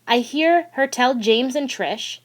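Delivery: plain reading voice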